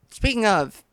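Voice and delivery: usual speaking voice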